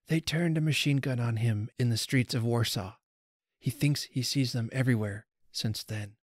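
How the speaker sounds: ordinary delivery, nothing marked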